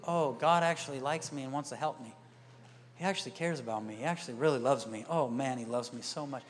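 low, soft, relieved realization; calm trust. Retold or imitated. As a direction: imitate a believer softening into a gentle request. Believer calmly returns to trusting God.

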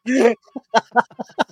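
high, loud burst of laughter. Real, not acted. Laughing at his own crude story, loud high laugh. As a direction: crack up at the punchline.

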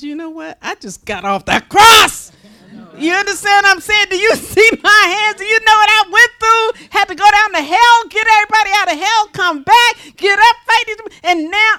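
fed up, let them have it; much attitude. Retold or imitated. Jesus scolding the disciples, loud and fed up. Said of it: "he says this with much attitude".

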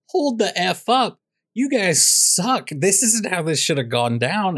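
high, drawn-out mock outrage; indignant protest. The Unsullied objecting to the choice of king, indignant.

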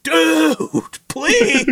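high, begging "Dude, please"; hammed-up pleading friend; mock desperate plea. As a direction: plead for help with a laugh. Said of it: "and he's like".

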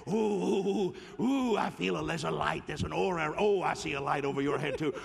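hammed-up ecstatic. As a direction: gush over the aura like an overexcited believer. Mocking ecstatic aura-seeing believer.

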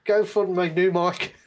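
high, laughing plea for money; joking. Acted. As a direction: joke begging for a new mic.

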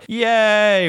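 high, loud mock crowd cheer; imitating a cheering crowd. Comic mimics the crowd cheering at his opener, loud and high.

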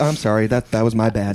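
mock apology. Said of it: "I'll be like".

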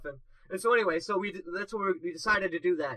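ordinary talk

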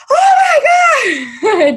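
high, loud fangirl shriek; mock movie-audience squeal; over-the-top reaction. Shown as a demonstration. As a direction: scream it like someone in a reaction video. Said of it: "those reaction videos".

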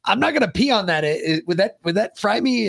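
mock kid question. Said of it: "It's like".

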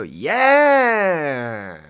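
long, high, loud mock Manchester drawl; mocking Manchester impression. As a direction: stretch it out as a hammed-up Manchester accent. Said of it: "People do impressions of me".

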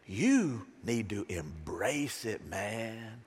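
low, very slow sage delivery; imitated wise friend. Wise friend advising a frustrated man, imitated sage. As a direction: stretch each word out low and slow.

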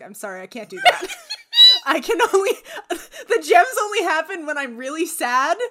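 giggling apology from the shark; mock apology broken by laughs; laughing refusal. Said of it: "Samebito's like".

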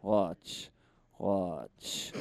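low, sparse street magician impression; David Blaine. Street magician tells a spectator to watch, low and deadpan. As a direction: draw out the suspense in a deep, quiet voice. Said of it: "give Ollie the voice of".

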